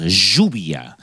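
"lluvia" with Argentine sh sound, shuvia. Shown as a demonstration. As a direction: Rioplatense "lluvia", the ll said as sh. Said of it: "Los argentinos decimos".

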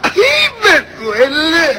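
drawn-out pained wail; cry of pain. Acted. Poor pregnant woman aching, hammed-up pained wail. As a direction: moan it slowly, hammed up as if in labour pains.

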